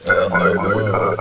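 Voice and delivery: slow, loud mock-stern no; loud refusal. Inspector denying a traveler, slow loud refusal. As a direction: draw it out, booming and mocking.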